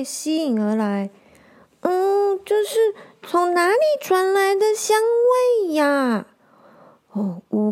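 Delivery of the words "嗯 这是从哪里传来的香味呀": high, bright little-animal voice, sniffing the air; small forest creature, curious. Acted. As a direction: wonder where that smell comes from. Curious animals smell soup.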